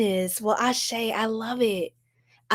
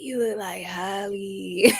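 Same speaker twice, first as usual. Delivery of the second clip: dudes' comment; 3 semitones lower, slower than usual. Retold or imitated. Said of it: "But they're like".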